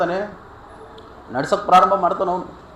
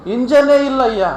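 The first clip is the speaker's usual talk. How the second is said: high, loud acted disbelief; acted exclamation of amazement; astonished reveal; 8 semitones higher, 7 dB louder than usual. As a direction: burst out with the impossible news. Officer reveals jeep has no engine.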